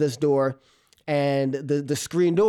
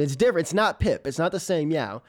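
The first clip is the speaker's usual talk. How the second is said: ordinary talk, nothing marked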